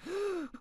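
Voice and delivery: high, soft mock gasp; sharp intake of shock, acted out; imitated shocked gasp. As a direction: suck in air like a stunned crowd. Imitates family's shocked reaction.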